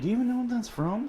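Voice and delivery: high, fast mock disbelief; incredulous. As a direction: fire it off in amused disbelief. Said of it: "And I'm like".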